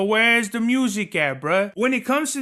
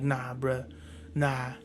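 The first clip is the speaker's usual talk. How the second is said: much softer, lower mock dismissal; dismissive nah; 7 semitones lower, 10 dB quieter, slower than usual. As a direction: imitate her shaking her head at him. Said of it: "give me this face and just shake her head".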